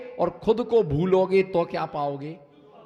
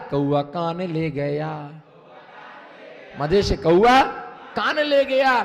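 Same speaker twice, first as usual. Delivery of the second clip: loud rhythmic recitation; playful sermon chant; sing-song refrain; 4 dB louder than usual. Acted.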